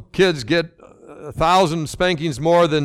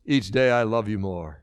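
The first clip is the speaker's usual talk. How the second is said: softer, lower, tender mother's line; soft and smiling; 6 semitones lower, 5 dB quieter than usual. A narrator's reading. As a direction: say it with a smile, gently. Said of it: "Her mother smiled and softly said".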